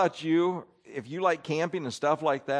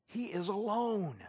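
hushed; 2 semitones higher, 10 dB quieter, slower than usual. Real, not acted. Preacher stresses Elijah's loneliness.

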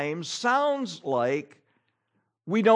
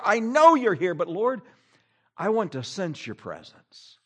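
sincere prayer; 4 semitones higher, 6 dB louder than usual. Shown as a demonstration. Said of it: "We need to be praying".

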